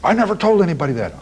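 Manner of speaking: high, quick protest of disbelief; imitated astonishment. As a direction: blurt it out as the astonished man. Retold killer astonished his secret is known.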